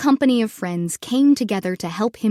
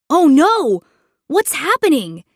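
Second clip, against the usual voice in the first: loud, high alarmed howl; 4 semitones higher, 5 dB louder than usual. Acted. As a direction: cry out in alarm. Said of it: "he howled".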